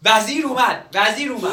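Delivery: high, loud alarm call; shouting. Acted. Young man faking the minister's arrival for the old man, shouting. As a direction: yell it out to distract him.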